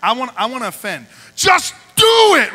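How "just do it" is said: shouting. Acted. Hyping up, Nike slogan.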